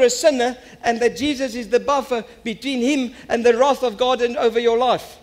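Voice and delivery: high, loud, driving sermon voice; impassioned preaching. Real, not acted. Preacher asks church why they follow Jesus, impassioned preaching. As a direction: press each point urgently on the congregation.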